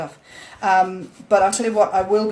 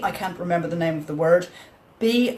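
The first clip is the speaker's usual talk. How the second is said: ordinary delivery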